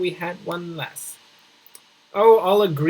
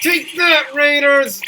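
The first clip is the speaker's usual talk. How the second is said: much higher and louder battle cry; triumphant shout; 8 semitones higher, 6 dB louder, slower than usual. Real, not acted. Player yelling at the raiders as he fires, triumphant shout. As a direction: yell it as the shots land.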